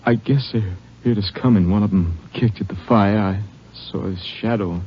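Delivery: halting recollection, long gaps; wounded, halting. Acted. Wounded deputy telling how they started blasting, halting. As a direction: piece the memory together with effort.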